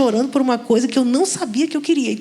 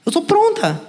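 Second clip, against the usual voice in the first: pitched well higher, "Eu sou pronta" sung out as a mocking boast; mock-proud claim; 8 semitones higher than usual. Woman mocking her past self: "Eu sou pronta", pitched high. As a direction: overplay your own certainty with a much higher pitch.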